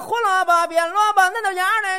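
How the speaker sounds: loud, high, drawn-out hawker's call of vegetables; street vendor's cry. Retold or imitated. Performing a vendor's cry for his partner, loud and high. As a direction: belt it out like a Beijing vegetable seller. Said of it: "什么样的？".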